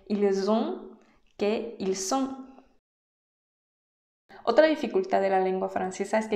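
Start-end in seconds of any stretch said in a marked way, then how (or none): 0.1-2.5 "ils ont" with a Z, "ils sont" with an S, slowly; slow contrast of liaison Z and S; Z versus S. Shown as a demonstration. Teacher contrasting ils ont and ils sont. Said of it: "no es lo mismo decir".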